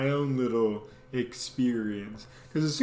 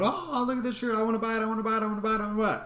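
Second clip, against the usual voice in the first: put-on much higher, pleading girlish whine; girlfriend's eager voice; 8 semitones higher, much faster than usual. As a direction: beg like a kid for the shirt. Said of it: "She's going".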